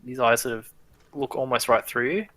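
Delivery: ordinary delivery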